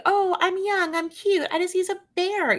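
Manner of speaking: high, sweet mock girly voice. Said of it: "it's like".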